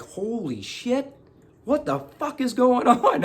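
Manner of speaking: stunned disbelief. Retold or imitated. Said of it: "just staring at me like".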